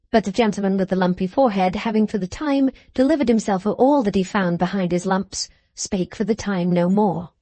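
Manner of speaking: ordinary delivery, nothing marked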